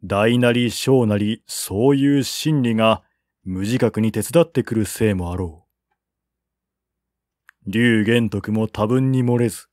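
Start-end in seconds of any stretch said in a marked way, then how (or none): none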